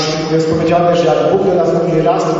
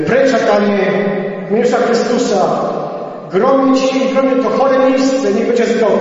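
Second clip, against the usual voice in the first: commanding rebuke; 7 semitones higher than usual. Retold or imitated. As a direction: cast him out with authority. Rebuking the devil in prayer. (podniesiony, rozkazujący głos)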